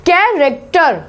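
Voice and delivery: कैरेक्टर said with a hard k and no h; silent H. Shown as a demonstration. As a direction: open कैरेक्टर with a plain k sound. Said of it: "यहाँ पे एच silent".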